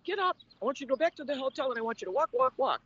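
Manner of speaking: doctor urging patient to walk after surgery; doctor's orders. Retold or imitated. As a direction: snap out the orders like an upbeat doctor.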